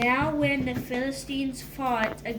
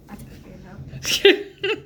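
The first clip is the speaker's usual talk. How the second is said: laughing; 9 semitones higher, 5 dB louder, much slower than usual. Real, not acted. Embarrassed reader handing off reading.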